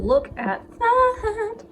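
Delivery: high, slow, delighted reveal; excited. Real, not acted. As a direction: show it off proudly. Showing off finished bake.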